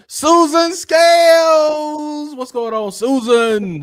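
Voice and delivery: high, loud call with the name held long; drawn-out shoutout. Real, not acted. Host welcoming a viewer by name, high stretched holler. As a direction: sing out the name in a big hype greeting. Hype shoutout greeting chat member.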